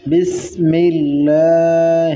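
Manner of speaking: slow drawn-out بسم اللہ; tajweed recitation. Shown as a demonstration. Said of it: "تو کیسے پڑھنا ہے؟". (آہستہ تجوید سے بسم اللہ)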